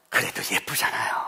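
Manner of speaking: softly whispered fond aside. Real, not acted. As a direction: whisper it tenderly to the congregation. Fond aside about kids. (다정한 속삭임)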